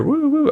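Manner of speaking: high falsetto woo woo howl; mock coyote howl. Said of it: "like, you know".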